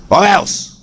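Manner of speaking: high, hammed-up threat; a storyteller's burglar threatening the abbot. A narrator's reading. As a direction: tack on a stagey burglar warning. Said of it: "the burglar shouted at the abbot".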